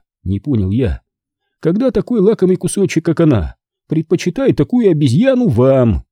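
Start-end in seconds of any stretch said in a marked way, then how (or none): 1.6-6.0 lightly voiced sly man, slightly higher; a hint of smug mockery; ехидный Тино. A narrator's reading. Tino taunts Holman. (слегка ехидно)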